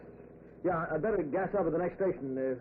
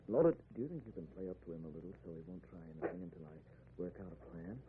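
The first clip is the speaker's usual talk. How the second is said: fast, much softer and lower; urgent hushed plea; 10 semitones lower, 9 dB quieter, faster than usual. Acted. Len urging his wife to stall the killer, fast hushed voice. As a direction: rush it under your breath.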